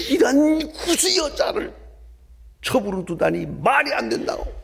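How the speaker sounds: high-pitched, indignant imitation of a sister; acted scolding, pitched well up; outraged criticism. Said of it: "막 비난을 했습니다".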